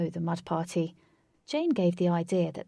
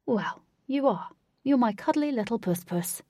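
cooing to a pet; 4 semitones higher, 3 dB louder than usual. Acted. Wraith cooing to cat.

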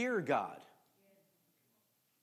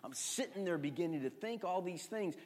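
ordinary delivery

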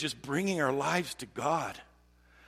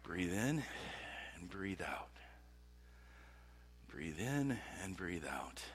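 soft, low breathing guidance; calming; 5 semitones lower, 12 dB quieter, slower than usual. Shown as a demonstration. Preacher leading listeners to stop and breathe in prayer, calming. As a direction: ease into a near-whisper with long pauses.

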